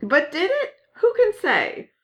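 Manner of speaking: high-pitched theatrical doubt; high mock doubt. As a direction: raise the pitch and ham up the doubt. Echoing the joke higher.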